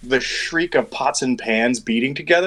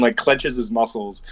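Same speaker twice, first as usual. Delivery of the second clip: normal talking voice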